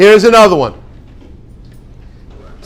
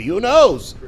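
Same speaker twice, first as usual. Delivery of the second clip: usual speaking voice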